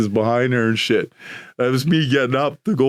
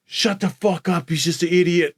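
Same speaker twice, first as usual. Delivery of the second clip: imitated coach yelling; 5 semitones higher than usual. Coach Billy snapping at the chanting crowd, mock yell. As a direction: bark it like an annoyed coach.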